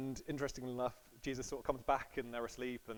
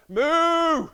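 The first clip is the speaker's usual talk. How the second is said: loud, high mooing cow; 19 dB louder than usual. Pretend. Preacher telling nephew's joke, loud cow moo. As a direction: belt out a big silly moo.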